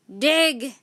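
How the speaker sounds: one loud barked order; pirate captain barks an order; shouted. A narrator's reading. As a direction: bark it at the crew. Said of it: "shouted Captain Bones".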